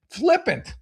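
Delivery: mocking echo. Shown as a demonstration. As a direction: throw the word flippant up high with a sneer.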